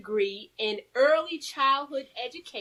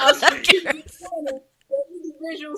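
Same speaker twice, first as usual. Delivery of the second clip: laughing through the words; laughing loudly at a failed word; 4 semitones higher, 7 dB louder than usual. Real, not acted. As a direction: giggle at your own tongue-twist. Laughing at her own stumble.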